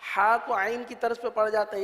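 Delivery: usual speaking voice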